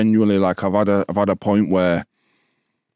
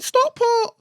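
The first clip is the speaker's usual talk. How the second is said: timid younger self. Retold or imitated. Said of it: "I'm like".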